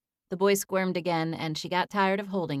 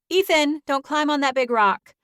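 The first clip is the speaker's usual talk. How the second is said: put-on higher, louder call to child; 8 semitones higher, 8 dB louder than usual. A narrator's reading. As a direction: call across to the boy. Calling to child away.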